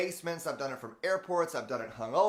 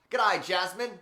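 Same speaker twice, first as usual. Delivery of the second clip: mock Australian greeting, higher and louder; hammed-up Australian accent; 5 semitones higher, 6 dB louder than usual. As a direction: toss off a playful Aussie g'day. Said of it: "now I'm mixing up my accent".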